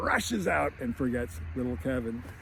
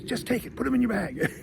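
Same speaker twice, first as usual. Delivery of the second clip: high mock wife voice; 8 semitones higher than usual. Wife urging husband to pocket the glasses, in a high mock voice. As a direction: coax him like a sneaky wife, pitched up. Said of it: "She goes".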